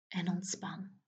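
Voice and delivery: soft breathy whisper; whispered release. Acted. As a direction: let it go in a whisper. Release cue after tensing.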